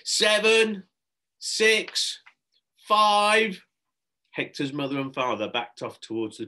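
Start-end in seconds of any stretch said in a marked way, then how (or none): 0.0-3.6 high, loud chanted count; a roomful of kids chanting; loud high group chant; children joining the countdown. A narrator's reading. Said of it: "All the children began to join in".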